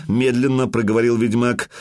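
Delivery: plain reading voice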